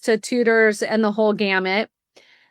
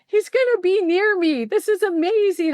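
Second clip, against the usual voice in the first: excited mom; 8 semitones higher than usual. Retold or imitated. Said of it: "I was so excited".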